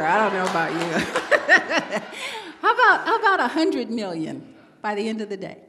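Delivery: laughing while teasing the crowd. Real, not acted. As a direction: chuckle through the question. Joking with audience, laughing.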